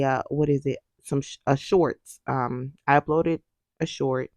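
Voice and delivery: normal talking voice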